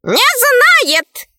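very high bird squawk; emphatic talking bird. A narrator's reading. Right head confirms the dog knows nothing, high bird voice. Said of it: "подтвердила правая голова говоруна".

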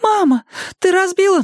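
put-on high, loud childlike cry; a child shocked by the crash; startled little girl. A narrator's reading. Girl to her mother after the vase breaks, high startled cry.

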